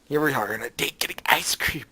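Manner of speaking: unvoiced narrating whisper. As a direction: hush the line. Narrating in hushed aside.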